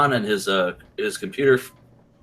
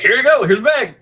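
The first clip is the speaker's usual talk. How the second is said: laughing dude voice; 13 semitones higher, 5 dB louder than usual. Retold or imitated. Flea market dude quoted.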